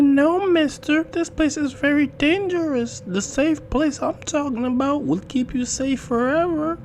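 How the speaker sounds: put-on very high child voice, loud at first; high-pitched, insistent girl; eerie little girl. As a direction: pipe it like a small girl luring a stranger.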